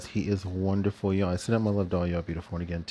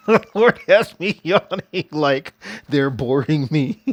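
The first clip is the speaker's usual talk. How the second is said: laughing through the words, slow, louder and higher; 6 semitones higher, 6 dB louder, slower than usual. Real, not acted. Telling her viewers how the Lord makes her yawn, laughing. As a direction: giggle it out, tickled by the idea.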